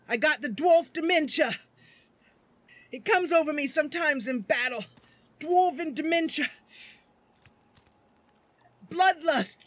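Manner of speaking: heaving dwarf in battle frenzy, loud and high; Beast. Acted. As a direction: shout it as a blood-crazed dwarf. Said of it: "Beast is heaving. His chest is heaving".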